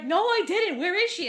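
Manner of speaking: high, loud protest of innocence; mock indignant denial. Retelling dad denying it on the phone, mock indignant denial. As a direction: imitate someone wrongly accused, voice jumping up.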